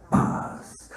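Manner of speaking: usual speaking voice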